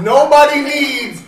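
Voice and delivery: high, loud and slow; exasperated outburst. Real, not acted. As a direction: burst out in disbelief. Exasperated at long papers.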